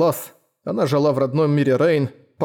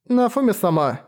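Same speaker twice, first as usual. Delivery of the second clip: put-on child voice; 7 semitones higher than usual. Child calls the hero.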